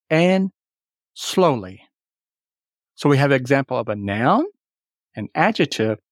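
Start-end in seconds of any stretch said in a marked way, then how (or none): 1.1-1.8 "slowly" said alone, SLOW strong, ly falling away; first-syllable stress. Shown as a demonstration. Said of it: "the accent falls on the first part".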